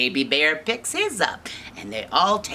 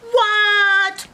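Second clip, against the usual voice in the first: very high, loud "what"; drawn-out comic shock; shocked bear parents; 8 dB louder than usual. Acted. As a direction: shriek it in disbelief. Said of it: "Mama and Papa Bear are like".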